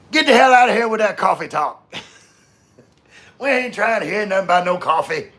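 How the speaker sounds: high, loud put-on voice telling them off; squeaky mock scolding. Mock scolding of coffee talk.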